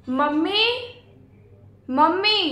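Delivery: calling out. Acted. Calling mother from another room.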